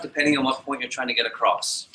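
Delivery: ordinary delivery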